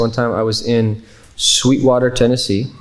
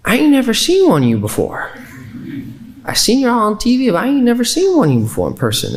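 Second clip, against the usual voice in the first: put-on very Southern accent; 11 semitones higher than usual. Small-town Tennessee lady meeting her first Muslims, very Southern accent. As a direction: imitate an amazed Southern woman, pitched well up. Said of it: "in a very Southern accent".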